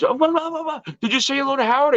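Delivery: high-pitched, animated prompting; retold eager question. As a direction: imitate a friend urging you on. Don's excited question, acted out.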